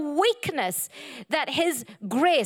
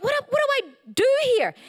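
flustered; 9 semitones higher, much faster than usual. Retold or imitated. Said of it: "And I thought".

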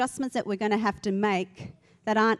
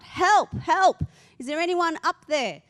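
raised, high mock cry for help; acted yelling out; 7 semitones higher, 5 dB louder than usual. Man dangling from cliff calls for rescue, acted yell. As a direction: call up for help, storytelling-style. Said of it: "He started yelling out".

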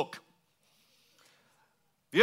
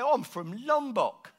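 higher-pitched mock British accent; strong British accent; 7 semitones higher than usual. Island local telling visitor where he's from, strong British accent. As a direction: answer in a put-on British accent. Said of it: "You've got a very strong British accent".

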